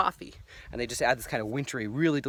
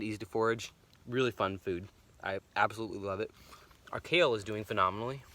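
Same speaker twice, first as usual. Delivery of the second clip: ordinary talk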